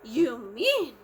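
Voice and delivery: gasped surprise. A narrator's reading. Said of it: "gasped Horton".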